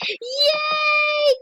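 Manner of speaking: high, drawn-out squeal of joy; excited cheer. Real, not acted. Player delighted by a reply, excited cheer. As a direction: let out a long high yay. Celebrates being answered.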